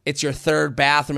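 ordinary talk, nothing marked

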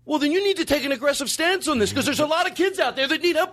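ranting, voice pushed way up; worked-up rant. Real, not acted. Heated rant at co-host about the church, pitched high.

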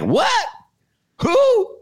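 high, startled what and who; mock baffled questions; puzzled surprise. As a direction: jump way up in confusion. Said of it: "She's like".

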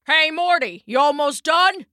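casual yell across the yard; calling out. A narrator's reading. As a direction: holler over to a workmate. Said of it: "came a shout from around the corner".

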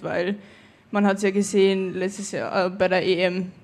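ordinary talk

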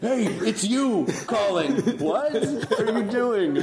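slow, high, laughing put-on voice; drawn out and giggly, playing the man woken up; mock surprised caller. To the man they are phoning at 3 AM, slow high laughing voice. Imitating the impersonated guy answering.

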